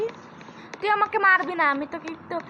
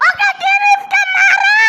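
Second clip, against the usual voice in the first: high, slow, loud rallying yell; excited shout; 11 dB louder, slower than usual. Real, not acted. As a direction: urge the team on at full volume. Screaming command in fight. (উত্তেজিত জোরে আদেশ)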